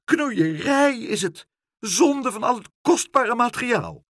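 high, loud outrage; scandalised high-pitched tirade; shouting. A narrator's reading. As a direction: shout your disgust, pitched right up. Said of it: "schreeuwde mevrouw Helderder".

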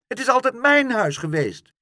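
loud, high protest; outraged cry. A narrator's reading. Pluk insists to the woman it's his, outraged cry. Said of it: "riep Pluk".

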